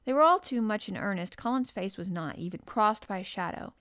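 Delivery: ordinary delivery, nothing marked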